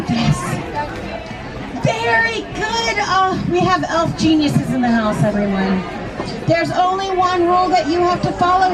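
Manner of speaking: plain reading voice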